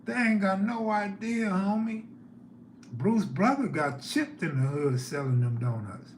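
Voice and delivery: ordinary talk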